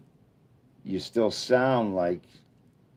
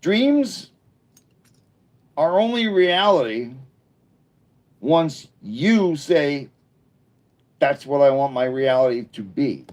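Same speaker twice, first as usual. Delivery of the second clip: motivational speech; 5 semitones higher, 5 dB louder than usual. Real, not acted.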